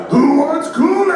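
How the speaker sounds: very loud, high sing-out; gleeful holler. Retold or imitated. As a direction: holler it like a cartoon mascot bursting in. Acts out two men bursting in.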